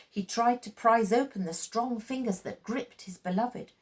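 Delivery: ordinary delivery, nothing marked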